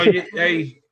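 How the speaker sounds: usual speaking voice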